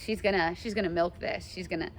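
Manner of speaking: normal talking voice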